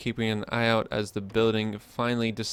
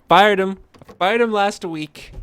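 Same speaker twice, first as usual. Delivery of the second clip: mock outburst; 8 semitones higher, 9 dB louder than usual. Jokey rant about fired interns.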